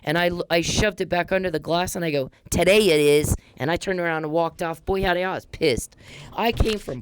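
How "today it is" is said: loud, emphatic comeback; retold defiant retort. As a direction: throw it back forcefully, much louder. Angry retort retold, shoved papers back.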